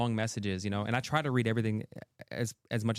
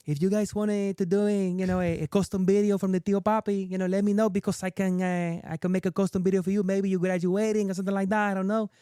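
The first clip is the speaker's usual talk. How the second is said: put-on Tio Papi accent; 8 semitones higher, 4 dB louder than usual. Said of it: "and I, I did the accent".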